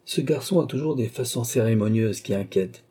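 plain reading voice

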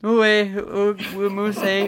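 high, silly moose noises; goofy cartoon moose voice; dopey moose voice. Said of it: "Do the voice, the moose voice".

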